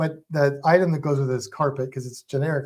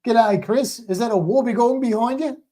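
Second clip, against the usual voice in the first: playful Aussie greeting, higher pitch; mock Australian accent; 6 semitones higher than usual. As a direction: ham up an Australian hello to the viewer. Greeting viewer, Aussie joke.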